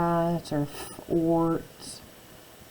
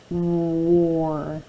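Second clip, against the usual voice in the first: normal talking voice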